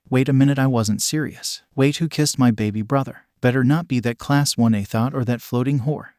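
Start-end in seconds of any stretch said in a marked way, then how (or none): none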